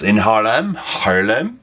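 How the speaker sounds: Haarlem with a Dutch-sounding vowel, quizzical rise; a playful hint of a Dutch accent; light Dutch accent. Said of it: "I keep slipping into this ... Dutch, uh, accent".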